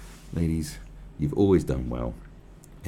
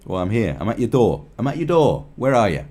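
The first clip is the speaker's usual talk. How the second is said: loud calling out at the door; mock doorstep challenge; mock confrontation; 4 semitones higher, 9 dB louder than usual. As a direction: challenge him to show up, loudly. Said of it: "And then you say".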